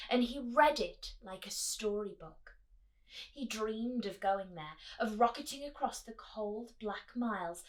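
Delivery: put-on reading to children. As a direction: draw the kids into the story. Reading picture book aloud.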